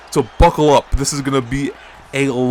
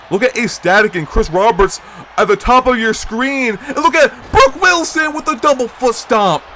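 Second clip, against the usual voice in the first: excited play-by-play; 6 semitones higher than usual. Acted. Commentator hyping Wilson's kick and stomp, rising excitement. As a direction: build the hype as the moves land.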